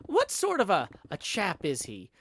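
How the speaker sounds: loud, high, theatrical query; indignant questioning. Acted. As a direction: demand an answer from the innkeeper. Questions landlord in story dialogue.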